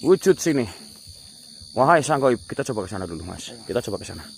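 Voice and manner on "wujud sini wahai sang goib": loud commanding call, higher; summoning a spirit. Real, not acted. Ghost hunter commands the crying spirit to appear, loud. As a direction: order the spirit to show itself.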